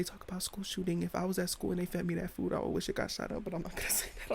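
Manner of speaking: hushed, under-the-breath confession; not loudly. Real, not acted. Comic slipping in a forbidden joke, under the breath. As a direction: sneak the joke out very softly. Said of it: "at least not loudly".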